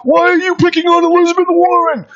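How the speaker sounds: high-pitched, loud caricature of a man complaining; exaggerated high yelling impression; mocking colonel's voice. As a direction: put on a high, strained mock voice and scold. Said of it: "he used to have a voice and talk like this".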